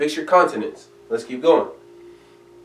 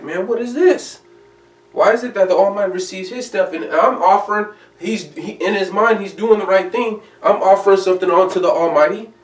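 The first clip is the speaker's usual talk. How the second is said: higher-pitched, aggrieved complaint; indignant Cain; 6 semitones higher, 4 dB louder than usual. Acted. Cain protesting to God about his rejected offering, aggrieved and higher-pitched. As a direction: voice Cain's hurt protest, pitched up. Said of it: "He was like".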